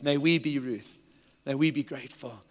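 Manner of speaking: ordinary delivery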